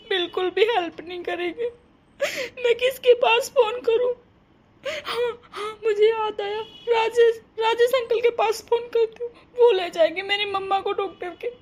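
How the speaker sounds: high tearful sobbing words, voice breaking; crying girl. Acted. A frightened daughter sobbing while trying to get help for her mother. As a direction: cry out in helpless panic.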